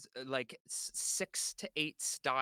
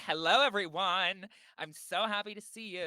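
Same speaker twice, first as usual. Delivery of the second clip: louder campy greeting voice; 4 semitones higher, 7 dB louder than usual. Pretend. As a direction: gush like a camp host. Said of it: "sassy can be campy".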